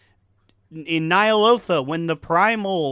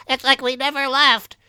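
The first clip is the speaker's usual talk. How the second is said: high mock voice; 10 semitones higher, 4 dB louder than usual. Quoting the game item.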